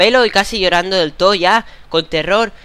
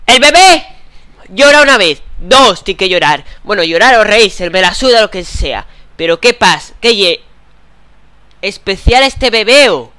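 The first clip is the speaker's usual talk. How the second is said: loud, raised ranting at the game; angry rant; 4 semitones higher, 10 dB louder than usual. Real, not acted. Gamer ranting at the baby that won't cry, loud.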